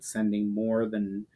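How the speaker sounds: ordinary delivery, nothing marked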